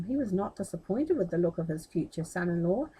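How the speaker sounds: ordinary delivery, nothing marked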